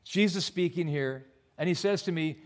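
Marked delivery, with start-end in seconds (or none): none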